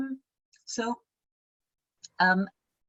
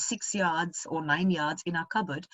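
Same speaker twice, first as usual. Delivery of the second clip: ordinary talk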